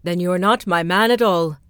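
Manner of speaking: haughty rat king; proud rat king. Acted. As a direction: brush him off haughtily. Rat King dismisses the sun.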